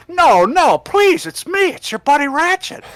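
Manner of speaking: high, loud, panicked pleading; begging for his life. Retold or imitated. Robot medic begging the mob chasing him, panicked high pleading. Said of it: "running from a town of people and saying".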